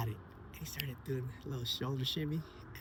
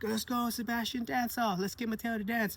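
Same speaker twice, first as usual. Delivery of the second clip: excited cheering; 10 semitones higher than usual. Retold or imitated. Dad cheering from car seat.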